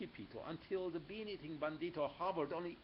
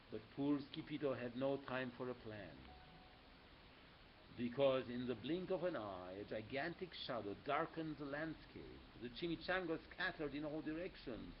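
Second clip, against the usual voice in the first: ordinary delivery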